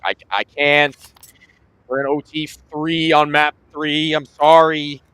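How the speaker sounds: mock pleading, loud and slow; apologetic gamer. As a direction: plead like a stuck player. Acted player apologizing to family.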